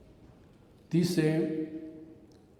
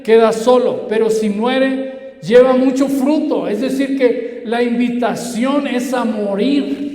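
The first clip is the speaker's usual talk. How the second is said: raised, building proclamation; full-force preaching; 4 semitones higher, 6 dB louder than usual. Real, not acted. Preacher calling listeners to die, raised forceful preaching. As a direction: build it louder and higher into the invitation.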